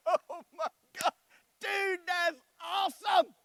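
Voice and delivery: father cracking up at his son's joke; delighted shout. Retold or imitated. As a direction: howl it with delight.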